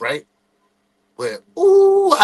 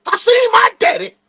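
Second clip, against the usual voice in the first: high, loud excited yell; excited shout; 5 dB louder, slower than usual. Retold or imitated. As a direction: burst in shouting the news. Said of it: "went to the crib like".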